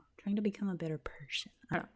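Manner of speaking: unvoiced self-conscious whisper; hushed sheepish aside. Real, not acted. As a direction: whisper it like a secret.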